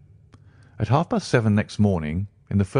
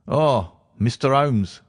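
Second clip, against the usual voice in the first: high, slow hearty greeting; a police inspector warmly welcoming Holmes; 5 semitones higher, slower than usual. A narrator's reading. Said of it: "said he heartily".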